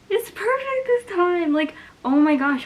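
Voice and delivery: high, loud burst of relief; thrilled exclamation. Real, not acted. Book creator relieved it is perfect this time, thrilled exclamation. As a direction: let real delight push the voice up. Relieved replacement arrived intact.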